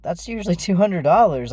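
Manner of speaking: retold snap, offended and pointed; snapping back. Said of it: "snapped back with".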